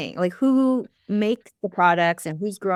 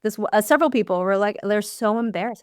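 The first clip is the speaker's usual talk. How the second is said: ordinary talk, nothing marked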